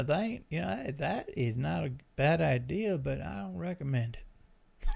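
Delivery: quiet put-on Southern accent; Southern guy. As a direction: advise like an easygoing Southern guy. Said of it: "Southern guy in my voice".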